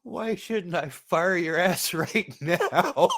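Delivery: slow, laughing mock-boss line; playful imitation of the chief, drawn out; imitating the chief. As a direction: quote the angry chief while cracking up. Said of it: "That's gonna be a quote from now on".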